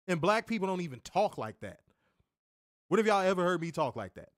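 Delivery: usual speaking voice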